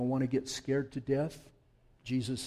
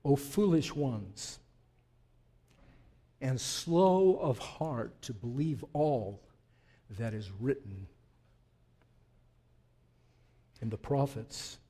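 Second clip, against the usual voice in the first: scripture reading; 2 semitones lower, slower than usual. Acted. Reads Jesus' rebuke from scripture.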